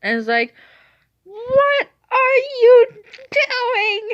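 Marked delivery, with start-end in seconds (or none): 1.2-4.1 very high, slow, loud screech; exasperated shriek. Retold or imitated. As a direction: screech each word in disbelief. Yells at grandma in disbelief.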